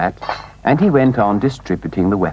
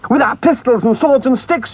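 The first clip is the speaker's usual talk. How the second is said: high, eager shout; excited shout; 12 semitones higher than usual. A narrator's reading. Rat rallying friends for the raid, high eager shout. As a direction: Rat shouts the weapons list with glee. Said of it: "shouted the Rat".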